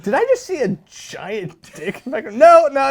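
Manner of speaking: high-pitched incredulous reaction; mock shock. Caller glimpsing something on FaceTime, high mock shock. Acts out shock on a video call.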